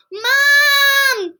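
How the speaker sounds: loud, high call for Mom; yelling. Acted. Kipper yelling for Mom. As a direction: shout downstairs. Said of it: "yelled Kipper".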